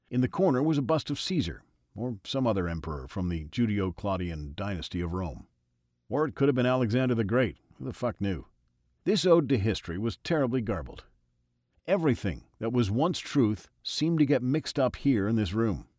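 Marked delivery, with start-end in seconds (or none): none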